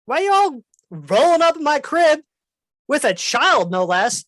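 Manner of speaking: high, loud scolding creature voice; indignant dragon. Acted. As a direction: screech at them for barging in. Dragon accuses intruders.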